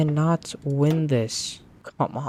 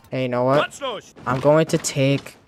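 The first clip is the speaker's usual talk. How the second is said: normal talking voice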